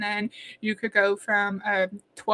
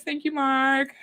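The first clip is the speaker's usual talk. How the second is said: ordinary talk, nothing marked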